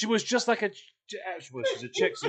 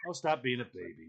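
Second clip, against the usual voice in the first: low, soft mock brush-off; quiet, dismissive retold jab; 8 semitones lower, 9 dB quieter than usual. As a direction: wave it away under your breath.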